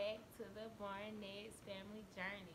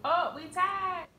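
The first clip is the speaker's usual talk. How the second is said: surprised; 9 semitones higher, 15 dB louder, slower than usual. Real, not acted. Surprised at tie.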